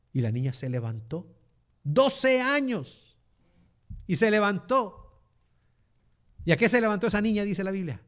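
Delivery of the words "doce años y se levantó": slow, loud, higher exclaim; marvelling emphasis. Real, not acted. Preacher marvelling to the congregation at the twelve-year-old raised, slow and loud. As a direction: let the wonder ring out slowly.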